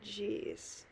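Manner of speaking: ordinary delivery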